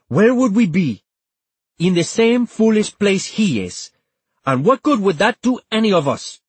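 high, insistent, angry argument; agitated rhetorical tirade; heated arguing. A narrator's reading. Antonia argues heatedly in quarrel.

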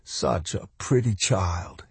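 soft, slow inward thought; hushed sorrow. A narrator's reading. Man pitying the dying child, hushed and sad. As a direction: think it aloud, slow and soft.

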